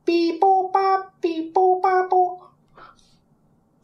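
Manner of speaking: high, slow robot beeping; robotic katakana speech. Acted. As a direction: chant it like a machine. Computer sound effect bit.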